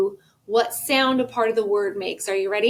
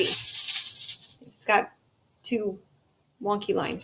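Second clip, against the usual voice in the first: quiet aside; 8 dB quieter, slower than usual. Real, not acted. Teacher aside, dropped voice.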